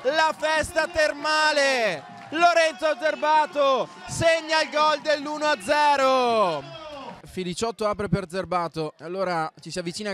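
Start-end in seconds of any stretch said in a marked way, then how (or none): 0.0-6.6 much higher, screaming the name, drawn out; goal celebration shout. Real, not acted. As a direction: explode with joy at the goal. Goal scored, commentator erupts.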